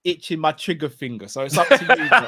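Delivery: said through a chuckle; laughing. Real, not acted. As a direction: grin and joke it out.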